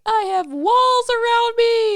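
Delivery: high, loud, slow mocking voice; mock stubborn voice. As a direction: ham up a closed-off person. Mocking closed-off people.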